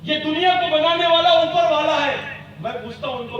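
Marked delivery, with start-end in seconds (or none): 0.0-2.2 booming rousing general speech. Real, not acted. Rousing rally speech, raised voice.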